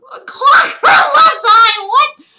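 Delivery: high, loud shocked shout. Acted. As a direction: shout in disbelief. Shocked reaction to clock eye.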